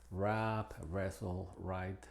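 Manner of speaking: wrestle and write said with a silent w, starting on the r; silent w demo. Shown as a demonstration. Teacher showing learners the silent w before r. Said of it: "es muda cuando precede a la R".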